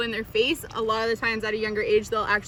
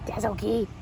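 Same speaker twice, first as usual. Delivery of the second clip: reassuring; 3 semitones lower, 4 dB quieter than usual. Real, not acted. Instructor comforts baby Sophie, soft reassurance. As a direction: ease off and comfort her.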